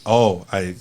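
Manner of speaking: normal talking voice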